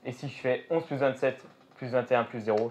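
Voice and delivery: usual speaking voice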